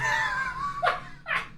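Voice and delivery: squealing burst of laughter; shrieking giggle; high helpless laugh. Real, not acted. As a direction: crack up completely. Captions fail, streamer loses it.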